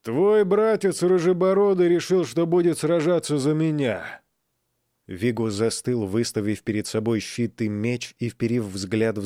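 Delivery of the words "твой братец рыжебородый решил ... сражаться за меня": loud, high mocking call; taunting shout. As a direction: jeer it down at him. Agni taunts from above the pit.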